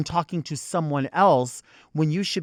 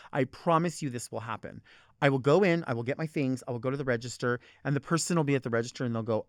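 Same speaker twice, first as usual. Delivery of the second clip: normal talking voice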